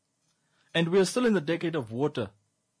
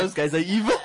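usual speaking voice

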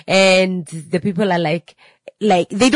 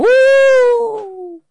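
a high, loud, hammed-up wail; hysterical wail; 8 dB louder than usual. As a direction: shriek like a mother losing it. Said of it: "became extra now".

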